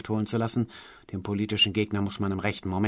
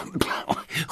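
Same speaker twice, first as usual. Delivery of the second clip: choking wheeze; 6 semitones higher, 4 dB quieter than usual. Acted. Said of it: "röchelte er gebückt keuchend".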